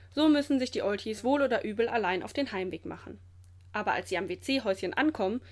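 ordinary delivery, nothing marked

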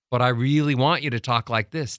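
full, connected stream of sound; supported voice. Shown as a demonstration. Coach showing the wanted way, supported voice. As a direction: carry the words out on steady air. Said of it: "Do you hear the difference?".

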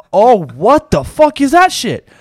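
high, slow, loud freaked-out question; a startled store clerk hearing something in the back; alarmed. Retold or imitated. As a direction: react as if a weird noise is coming closer. Noise getting closer, nervous.

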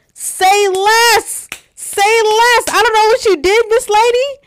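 very high, loud, overjoyed cry; elated shouting. Retold or imitated. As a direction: shout it out, bursting with relief and joy. Acting out joyful reply to dean.

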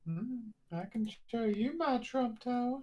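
high, slow, suggestive voice; creepy flirty lady. Pretend. Friend mocks the popsicle lady, high, slow and suggestive. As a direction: purr it out like a creepy seductress.

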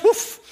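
short, high imitated bark; dog's woof. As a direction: give a quick high-pitched woof. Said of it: "says the dog".

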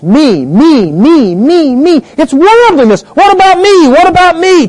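usual speaking voice

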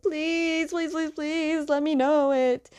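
begging. Retold or imitated. Sokka begging Toph for secret.

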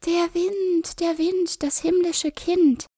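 put-on high, slow innocent child voice; children's voices. A narrator's reading. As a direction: answer sweetly like small children. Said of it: "sagten Hänsel und Gretel".

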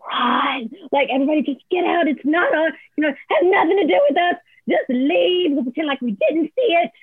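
high, hurried mock panic; comic conspiratorial alarm, rising high; imitated panicked urging. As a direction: urge the team to flee, pitch climbing. Retold urge to flee the scene.

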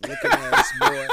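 high, laughing mock jab; laughing insult. Real, not acted. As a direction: cackle the words out. Mocking friend, laughing.

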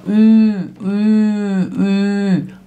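"Ü, ü, ü" each held on a level note; isolated vowel demo. Shown as a demonstration. Teacher drilling the vowel for learners, sustained separate "ü"s. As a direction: repeat long, steady rounded "ü" sounds. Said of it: "Aqui, ó".

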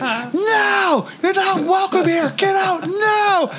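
very high shouted eviction; ghostly shout. Retold or imitated. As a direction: yell like an angry spirit, slowing at the end. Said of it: "And it's like".

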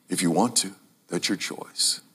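soft whisper. Retold or imitated. Paul voiced gently.

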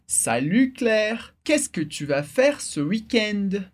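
each syllable slowed for learners. Shown as a demonstration. Teacher repeating the line for students, slowly. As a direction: say it slowly and clearly, spacing the words. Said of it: "última vez um pouquinho mais devagar".

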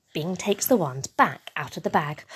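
plain reading voice

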